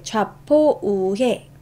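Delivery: 좌포우해 drawn out very slowly with a rise; slow recitation. Shown as a demonstration. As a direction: stretch each syllable for memory. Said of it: "closing the mnemonic slowly". (아주 느리게 끌어 말하기)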